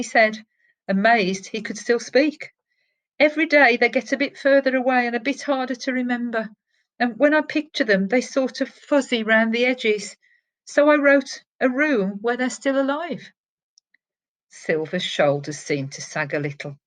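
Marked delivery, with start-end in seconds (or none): 3.2-13.3 higher and fast, anxious explanation; pleading boy. A narrator's reading. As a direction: rush it out, aching for his parents. Boy pleads explaining his wish.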